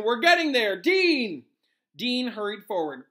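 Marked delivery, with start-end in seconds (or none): 0.0-1.5 high, loud encouragement; raised rallying yell; teacher's shout. A narrator's reading. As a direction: shout on, then call out the pupil. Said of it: "shouted Lupin".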